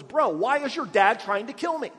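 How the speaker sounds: loud, high, casual bro voice; casual paraphrase. Acted. David asking Jonathan about his father, loud casual bro voice. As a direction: blurt it like a panicked buddy. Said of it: "In other words".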